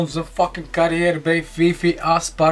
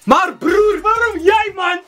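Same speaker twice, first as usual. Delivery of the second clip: exasperated shout; 14 semitones higher than usual. Real, not acted. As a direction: cry out in exasperated disbelief. Rant at the footballer. (verontwaardigd hoog uitroepen)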